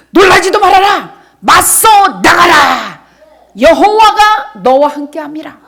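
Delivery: loud, high proclaiming, last words slow and shouted; full-force preaching. Acted. Pastor reading God's promise to the congregation, loud preaching. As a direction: proclaim the verse like a rallying cry.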